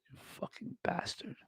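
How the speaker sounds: very quiet, breath-only curse; hushed swearing under the breath; whispered insult. Acted. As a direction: whisper it with contempt. Muttered insult at guest, unvoiced.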